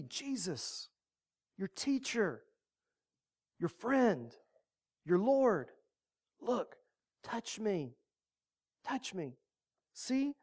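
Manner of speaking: soft, hushed comforting voice; calm them down like scared friends; gentle reassurance. Acted. Jesus to his startled disciples, soft and reassuring.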